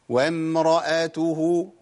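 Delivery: measured demonstration of "وامرأته" with held m; still-meem recitation. Shown as a demonstration. Teacher showing the silent meem, slow recital of "وامرأته". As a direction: recite "وامرأته" slowly, resting on the vowelless م and lengthening the final "hu". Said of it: "يبقى أسكن الميم".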